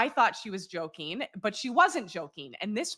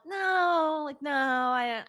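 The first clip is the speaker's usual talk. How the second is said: startled no; 6 semitones higher, much slower than usual. Retold or imitated. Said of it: "I'm like".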